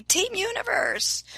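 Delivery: high, bright call of her catchphrase. Shown as a demonstration. Fitness influencer hailing her followers, high excited call. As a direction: sing out the name like a rallying cry. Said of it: "I always say".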